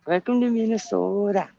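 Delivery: high mock Minnesotan lilt; Minnesota accent. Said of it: "weird accent to me".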